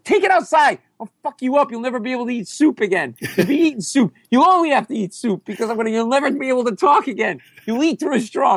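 very high, furious mock threats; hammed-up high-pitched screaming. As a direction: rant as if starting a fight. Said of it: "I'm screaming".

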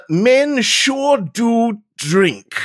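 slow, weighty reading of the note; slow dramatic reading. Pretend. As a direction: read each word slowly and gravely. Reads the note aloud slowly.

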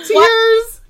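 high, loud squeal over tears; excited shriek. Real, not acted. Co-host sees tears during her friend's story, excited shriek.

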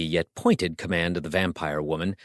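ordinary delivery

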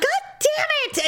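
high, exasperated mock yell; retold cursing. Retelling her bleeding finger, high exasperated cry. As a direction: curse it out at high pitch.

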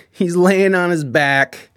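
ordinary talk, nothing marked

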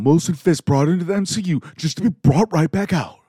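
high, loud mock outrage; hammed-up indignant complaint in a put-on voice; freaking-out fans. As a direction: imitate fans in an over-the-top freak-out. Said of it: "see people freaking out".

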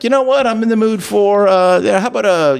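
high-pitched, musing customer order; mock casual diner. Diner telling the server his whim, mock casual musing.

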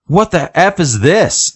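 high, drawn-out retold exclamation; imitated bewildered outburst; mock bewilderment. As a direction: act out a slow, high-pitched "what is this". Retold outburst before she passes out.

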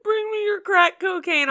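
put-on high, squealing Southern accent through laughter. Joking cohost plays drug smuggler, in a Southern accent. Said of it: "Southern accent".

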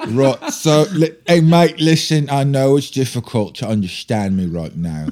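put-on thick, slurred British accent impersonation. Guest teacher apologising to his class, imitated British accent. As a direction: play a British teacher who is hard to understand. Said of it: "my British accent is an impersonation of a guy named [name]".

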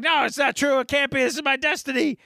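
high-pitched, hammed-up protest; frantic denial. Retold candidate lunging at his mocker, hammed-up frantic denial. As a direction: play a desperate man refusing to believe it.

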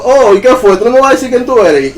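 calling out. Retold or imitated. Dreamer calls after the fleeing spirit, high and insistent. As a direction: call after someone fleeing, pitched well up. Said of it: "empecé a llamarlo".

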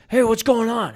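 put-on a dad checking on his kids; dad calling out. Dad calls out, concerned.

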